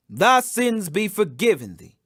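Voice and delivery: usual speaking voice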